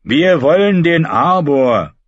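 slow, loud insistence; firm demand. A narrator's reading. Red Ralf demanding the Arbor, slow and loud. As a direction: plant your feet and demand it. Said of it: "sagte der rote Ralf".

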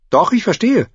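put-on high-pitched girl character voice; insistent young girl. A narrator's reading. Girl claiming she understands, high and insistent.

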